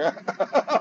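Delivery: high burst of laughter. Real, not acted. Teacher amused at saving ninety kalpas, laughing. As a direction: break into high-pitched laughing. Amused by his own remark.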